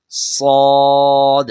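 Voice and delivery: سواد slow, thick and loud; heavy swaad. Shown as a demonstration. Teacher drawing out heavy swaad for pupils. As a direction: make سواد full and heavy. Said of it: "پھر repeat کیجئے".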